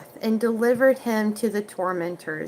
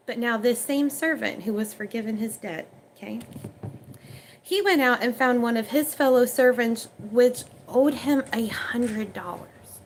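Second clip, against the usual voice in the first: ordinary talk, nothing marked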